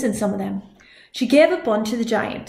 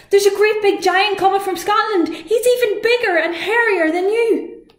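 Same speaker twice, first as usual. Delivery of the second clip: high, loud, breathless alarm; alarmed warning; 11 semitones higher, 5 dB louder than usual. Acted. Una warns sleepy Finn of the giant, alarmed. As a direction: warn him in a panic.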